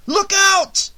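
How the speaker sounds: sudden alarmed shout; warning shout. A narrator's reading. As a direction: yell it before the crash. Warning driver of danger.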